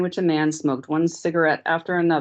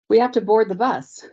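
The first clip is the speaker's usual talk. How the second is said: higher, louder desperate plea; cried, shaking; 6 semitones higher, 5 dB louder than usual. A narrator's reading. Layla pleads with the guard to let them board, frightened. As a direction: beg to get on the bus, voice near breaking. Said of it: "Layla cried, aware that her voice was shaking".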